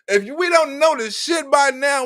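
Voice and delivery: ordinary delivery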